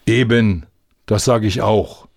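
ordinary delivery, nothing marked

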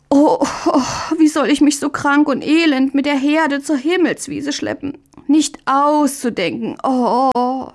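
high, wailing invalid's lament; milk the feigned misery, moaning the words; groaning harder. A narrator's reading. Möndlein faking illness for the worried brother, groaning harder. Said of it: "Möndlein ächzte noch stärker".

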